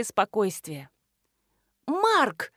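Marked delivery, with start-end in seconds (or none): 1.9-2.5 pitched-up exclamation, no real shout; mild narrated cry. A narrator's reading. Marina calling out to Mark in alarm, restrained cry. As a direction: call the name out high, in moderation. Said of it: "закричала Марина".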